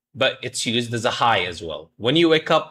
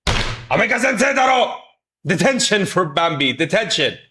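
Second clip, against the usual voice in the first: mock strict teacher; 6 semitones higher than usual. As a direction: play the outraged teacher handing out detention. Mock-scolding chat as strict teacher.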